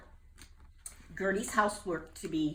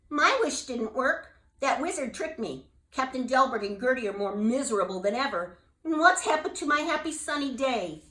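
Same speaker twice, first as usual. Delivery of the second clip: higher, louder dismayed complaint; upset bullfrog; 6 semitones higher, 6 dB louder than usual. Acted. Jubal on the toadstool, upset his wish failed. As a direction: feel cheated and sorry.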